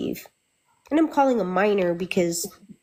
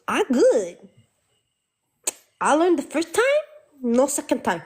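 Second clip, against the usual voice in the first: put-on high, sulky kid voice; childish refusal; mock bratty kid; 7 semitones higher than usual. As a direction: whine it like a stubborn child.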